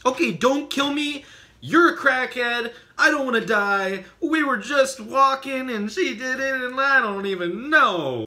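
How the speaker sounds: high, loud, hammed-up terror; panicked begging. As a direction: babble for mercy at high pitch. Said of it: "We were sitting there like".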